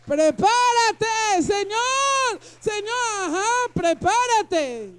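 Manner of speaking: high, loud, slow, near tears; mock panicked believer. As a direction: whine it like a scared believer. Creyente asustado, burla. (creyente asustado en burla, agudo y fuerte)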